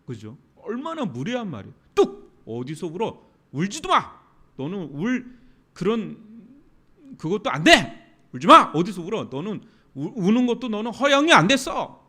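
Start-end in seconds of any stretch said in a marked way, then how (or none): 1.9-12.1 imitating a rude adult yelling at a mourner; louder and louder, snapping at her to stop crying; mock furious yell, much louder; harsh scolding. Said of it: "막 이렇게 그런 느낌인가".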